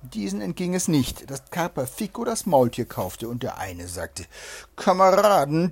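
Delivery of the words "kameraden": loud, raised call to comrades. A narrator's reading. Robber to his companions, calling out. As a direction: hail them with a strong voice.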